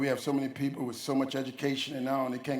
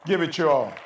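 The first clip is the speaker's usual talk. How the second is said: loud exhortation; urging; 6 dB louder than usual. Shown as a demonstration. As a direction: call the audience to action. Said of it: "that's all we asking of you all".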